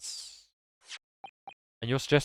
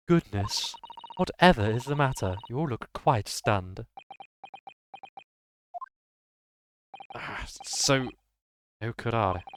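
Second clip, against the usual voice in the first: haughty English lady. Acted.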